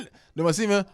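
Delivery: ordinary talk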